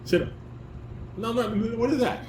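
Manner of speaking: ordinary talk, nothing marked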